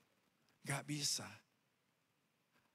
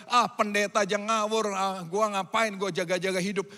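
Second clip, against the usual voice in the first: louder, dismissive mock complaint, dragging the middle; fed-up grumbling; 9 dB louder than usual. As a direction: grumble like you've given up. Disappointed believer voiced loudly. (mengeluh kesal dengan suara keras)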